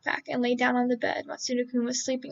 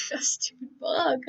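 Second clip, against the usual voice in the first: laughing; slower than usual. Real, not acted. Reader cracks up at the line.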